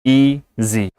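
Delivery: ईजी broken in two, ee, pause, zi; ईजी in two separate beats; split into syllables. Shown as a demonstration. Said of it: "दो syllable हैं".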